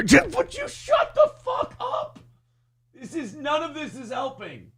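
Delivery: squeaky, high exasperated plea; a lawyer at the end of his rope; strained squeak. Retold or imitated. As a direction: crack up high in despair. Lawyer losing patience.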